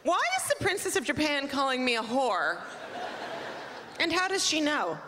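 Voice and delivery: baffled. Retold or imitated. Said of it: "I'm like".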